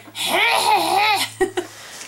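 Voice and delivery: a high, cracked mock rooster crow; imitating rooster crow. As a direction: squeak out a young rooster's broken crow. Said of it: "so it's like".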